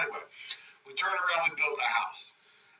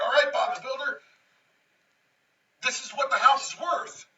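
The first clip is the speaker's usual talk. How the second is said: much higher, louder bracing tone; mock sing-song news; 10 semitones higher, 4 dB louder than usual. Pete telling the builder the house value, much higher. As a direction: deliver the number brightly.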